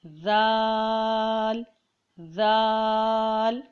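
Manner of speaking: ذال drawn long with a soft "th" as in "this"; tongue lightly on teeth, stretch ذال gently; soft zaal. Shown as a demonstration. Teacher demonstrating the letter, long soft ذال. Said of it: "نرمی سے پڑھنا ہے".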